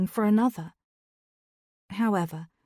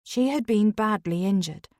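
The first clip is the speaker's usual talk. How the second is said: ordinary delivery, nothing marked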